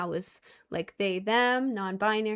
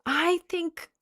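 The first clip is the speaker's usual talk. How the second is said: mock bullies; 5 semitones higher than usual. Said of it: "they're like".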